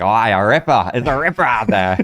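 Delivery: loud, hammed-up Aussie surfer; broad Aussie accent. Imitating older surfer praising waves, loud broad Aussie. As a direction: boom it out in mock Australian. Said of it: "an older guy who was about to go surf".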